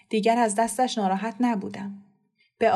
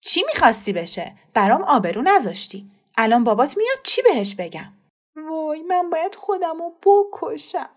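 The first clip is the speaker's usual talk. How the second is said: louder, bitter furious answer; spiteful reply; 8 semitones higher, 5 dB louder than usual. Acted. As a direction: snap back in exasperation. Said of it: "با حرص جواب داد".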